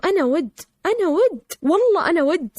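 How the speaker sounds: startled insistence. Retold or imitated. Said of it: "فجعت أقول لهم".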